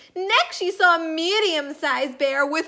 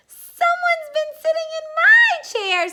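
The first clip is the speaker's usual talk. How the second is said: put-on squeaky baby bear; 5 dB louder than usual. A narrator's reading. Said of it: "squeaked Baby Bear".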